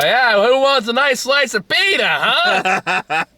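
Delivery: high-pitched, cheery mock sidekick; hawking Bread Boy. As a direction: offer it like an eager vendor. Voicing Bread Boy offering bread.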